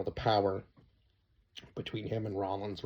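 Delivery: ordinary delivery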